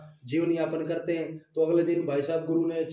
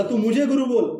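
loud, higher, proud order; arrogant command; 5 semitones higher, 5 dB louder than usual. Acted. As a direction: demand to be called guru, full of pride. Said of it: "तांत्रिक में थोड़ा घमंड था".

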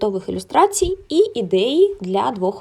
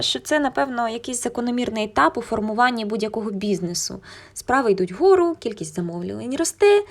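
usual speaking voice

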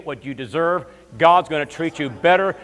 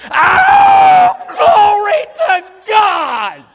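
very high, very loud, stretched-out cry of glory; a hammed-up ecstatic shout, drawn out slow; 11 dB louder, much slower than usual. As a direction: scream out the groom's joy. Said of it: "he was excited".

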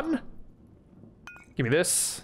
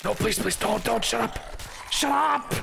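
desperate pleading; 5 semitones higher than usual. Real, not acted. Begging during the fight.

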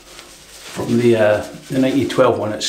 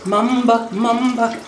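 much higher sing-song Mamba, Mamba; 12 semitones higher, slower than usual. Acted. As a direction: croon Mamba up high. Sings product name.

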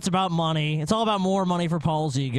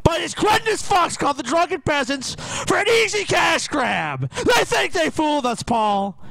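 hammed-up high shouting fury; outraged rant; 12 semitones higher, 4 dB louder than usual. Scolding Paul about spam videos, mock outrage. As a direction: build into a loud, high-pitched mock tirade.

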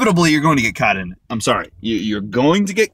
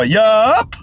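clipped, louder, higher yup; curt American yup; 7 semitones higher, 4 dB louder than usual. Retold or imitated. An American ending a chat with a European, curt yup. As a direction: shut it down with one word. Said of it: "they always go".

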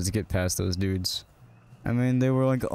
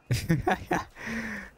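short, high chuckle; a quick laugh, higher than speech; amused laugh; 10 semitones higher, 4 dB quieter than usual. Real, not acted. Laughs at character's silly remark.

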